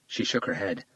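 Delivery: ordinary delivery, nothing marked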